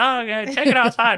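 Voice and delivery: imitating a bartender breaking up a fight; bartender calling out. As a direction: shoo brawlers out loudly.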